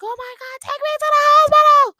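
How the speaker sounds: very high, very loud panicked scream. Retold or imitated. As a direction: shriek it like a panic attack at night. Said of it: "Like, screaming".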